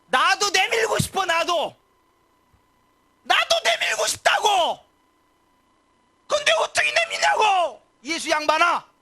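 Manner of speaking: very high, loud, slow crying shout; anguished shouted protest. Retold or imitated. The withered-hand man shouting at Jesus, high, loud and crying. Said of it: "이렇게 이야기하고 싶었을 거예요".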